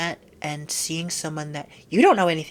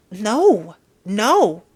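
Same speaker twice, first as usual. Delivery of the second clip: firm no; 3 semitones higher, 7 dB louder than usual. Real, not acted. Firm refusal.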